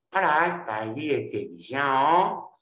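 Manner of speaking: normal talking voice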